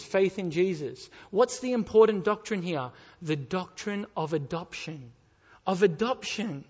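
normal talking voice

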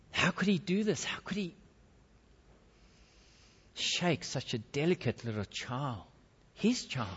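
soft, pained questioning; hushed disbelief. A narrator's reading. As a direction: ask in hushed disbelief, breaking off. Narrator dwells on father's remorse.

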